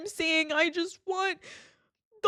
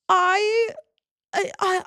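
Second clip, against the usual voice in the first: voice breaking; 2 semitones higher, 4 dB louder, much slower than usual. Real, not acted. Breaking down while venting.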